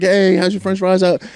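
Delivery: high, chirpy small talk, imitated; cheerful dad. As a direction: act out checking in with the kids. Retells chatting to his kids.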